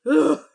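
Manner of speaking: a sudden loud, high groan of feeling; emotional groan. Real, not acted. Telling her story to viewers, a loud, high emotional groan. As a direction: let the emotion burst out as a pained ugh. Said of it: "where's all this emotion coming from?".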